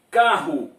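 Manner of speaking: "Carro" with a strong guttural rr, stressed; carro said clearly. Shown as a demonstration. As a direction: say "Carro" firmly with a throaty rr. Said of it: "Observe a palavra carro".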